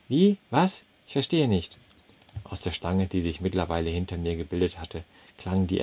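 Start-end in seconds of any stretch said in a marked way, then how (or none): 0.1-1.8 high, loud startled "Wie?"; baffled waitress. A narrator's reading. Waitress baffled by the customer's odd request, high and loud. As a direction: jump up in confusion.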